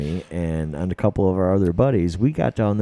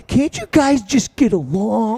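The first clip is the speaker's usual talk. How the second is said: much higher, louder, slow whiny plea; pleading Coy; 13 semitones higher, 5 dB louder, slower than usual. Retold or imitated. Host imitating Coy pleading with fighting dogs, high and whiny. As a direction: beg the dogs to get along. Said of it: "So he's like".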